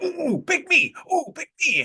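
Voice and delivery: put-on hand-waving kid begging the teacher to pick him; eager know-it-all kid. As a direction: play the kid bouncing with his hand up. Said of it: "the little kid who just knew all the answers".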